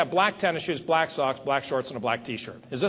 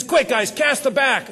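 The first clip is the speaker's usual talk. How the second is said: urgent order; 7 semitones higher, slower than usual. Retold or imitated. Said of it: "He says".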